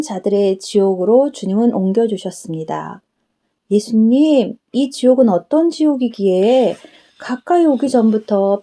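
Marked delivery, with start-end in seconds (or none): none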